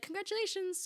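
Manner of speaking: high, soft imitated cheer; sing-song congratulations. Colleague with a chocolate board, high soft sing-song. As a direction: chirp it like a colleague handing over a gift.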